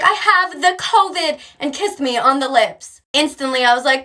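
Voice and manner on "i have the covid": put-on higher, slow, proud toddler; little kid voice. Small daughter telling mom she has covid, childlike. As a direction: announce it sweetly like a small child.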